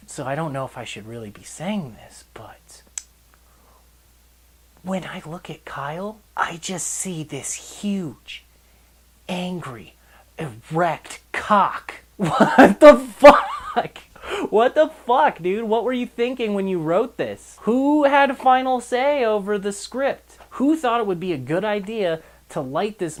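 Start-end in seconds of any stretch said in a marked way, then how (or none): 0.1-11.9 mock confiding whisper. Reviewer imitates the woman confessing about Kyle, hushed whisper. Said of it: "this woman is like". 12.2-20.4 laughing, loud, high disbelief; incredulous outburst. Real, not acted. Reviewer reacts to a ridiculous movie line, loud laughing disbelief.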